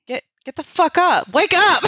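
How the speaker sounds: guy grinning while waking her up, mock yell; mock wake-up yell. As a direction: rouse a sleeper, grinning. Voicing him waking her.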